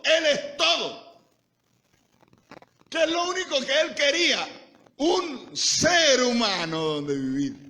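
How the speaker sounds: climbing, emphatic sermon delivery; full-force preaching. Acted. Preacher explaining God never wanted a structure, building full-force preaching. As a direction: build to high, punched declarations.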